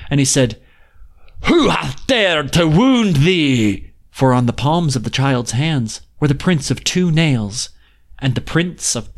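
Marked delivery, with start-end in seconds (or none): 1.4-3.9 very slow, higher, stern demand; a touch of an angry giant. A narrator's reading. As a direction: giant outraged at the child's wounds. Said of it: "his face grew red with anger".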